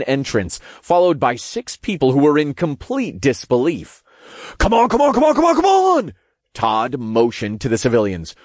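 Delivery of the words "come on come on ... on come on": high, loud, rapid-fire imp yell; frantic hurrying. A narrator's reading. Imp rushing civilians from the building, frantic. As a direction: hustle them out, shouting fast.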